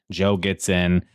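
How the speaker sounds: ordinary talk, nothing marked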